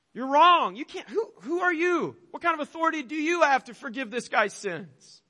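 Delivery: a touch of scandalised accusation; indignant Pharisees. Acted. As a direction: voice the experts' offended challenge. Religious experts objecting.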